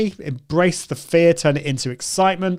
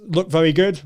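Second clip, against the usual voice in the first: usual speaking voice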